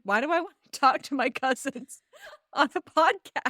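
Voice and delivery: a touch of laughter through the quote; half-laughing at the idea; mock bewildered question, giggling; laughing disbelief. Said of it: "I was just like".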